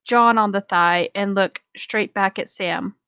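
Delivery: ordinary delivery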